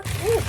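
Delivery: high, loud squeal of surprise; surprised ooh. Real, not acted. Vlogger finding a forgotten purchase, surprised squeal.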